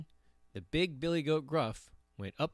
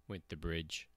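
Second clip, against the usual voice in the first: ordinary delivery, nothing marked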